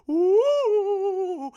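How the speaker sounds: long, high, loud ghostly wail; spooky "ooh". Acted. Narrator marking the scare in the film, spooky high wail. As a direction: draw out a high haunted-house "ooooh".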